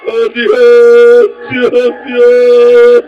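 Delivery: loud, tearful cry to God. Real, not acted. Preacher crying out to God in prayer, loud and tearful. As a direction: wail the name of God.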